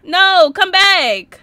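loud, drawn-out high call; calling after someone. Real, not acted. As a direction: yell after them as they run off, stretching the words. Calls after a fleeing character.

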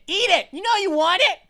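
loud teasing urging; mock coaxing shout. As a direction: yell it like you're baiting a pet. Urging a creature to eat.

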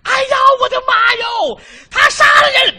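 put-on very high, loud screaming old woman; the mother-in-law screams at the news, very high and loud; terrified shriek. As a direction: shriek in horror.

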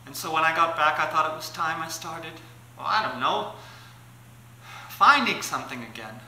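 serious monologue, low and soft with hesitant pauses; Tippi Hedren. Acted. Confiding to Rod Taylor on the dunes, quietly serious. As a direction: confide it quietly, searching for the words. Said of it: "Tippi Hedren, uh, on the, uh, on the dunes".

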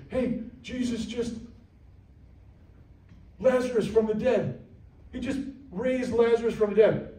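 high, breathless rushed news; excited messenger. Pretend. Messenger bursting in with news of Lazarus, excited.

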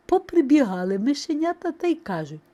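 ordinary delivery

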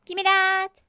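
loud, high, sustained playful cry; escalating demand. Pretend. Player grabbing at an item, mounting playful demand.

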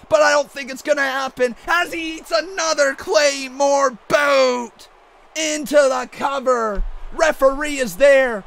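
excited wrestling commentator calling the action; hyped ringside play-by-play; play-by-play commentary. Acted. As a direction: call the match like a ringside announcer.

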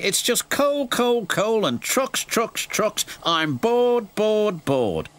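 high, bored complaint with weary repeats; sighing Percy. A narrator's reading. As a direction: moan each repeated word in a high voice. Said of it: "sighed Percy".

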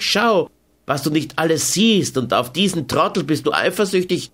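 mock his jealousy, then scheme; Carmen, scornful and sly. A narrator's reading.

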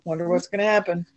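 usual speaking voice

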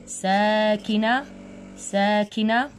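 ساكنة stretched very slowly; slow emphatic teacher read; drawn out. Shown as a demonstration. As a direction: hold out each syllable of ساكنة. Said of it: "ونقول مرة أخرى".

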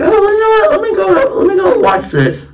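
high, bright sing-song gush; mock excited. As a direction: gush like you just got sold on it. Mock excited self-quote.

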